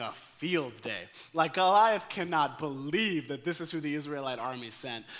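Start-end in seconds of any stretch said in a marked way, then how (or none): none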